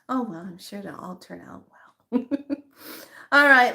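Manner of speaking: normal talking voice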